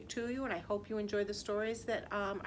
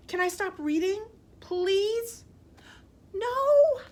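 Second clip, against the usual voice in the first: pleading; 9 semitones higher, 7 dB louder than usual. Acted. Reader pleading to stop.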